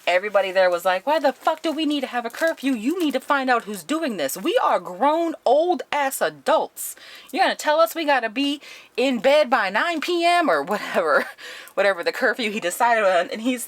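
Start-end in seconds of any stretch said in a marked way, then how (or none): 1.1-10.5 high-pitched, indignant mock outrage; outraged protest. Residents objecting to the director's curfew, mock outraged. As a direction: act out a crowd of fed-up residents.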